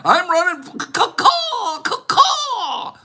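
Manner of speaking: high cawing through real laughter; can't stop laughing while cawing like a crow; laughing crow caws. Real, not acted. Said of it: "I'm calming down".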